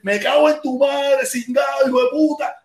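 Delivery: high, slow shouted insults; furious cursing. Pretend. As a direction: spit each insult out at him. Pretend insulting the host.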